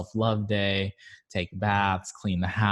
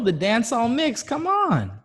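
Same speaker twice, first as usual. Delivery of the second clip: much higher and louder, eager; excited; 5 dB louder than usual. Real, not acted. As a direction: burst out with delight. Excited shout about the mix.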